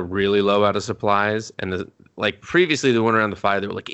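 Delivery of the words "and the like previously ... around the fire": usual speaking voice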